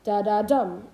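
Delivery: Da-da-dum, two light beats then a strong one; stress on dum. Shown as a demonstration. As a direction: land hard on the dum in Da-da-dum. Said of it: "two unstressed syllables followed by one stressed".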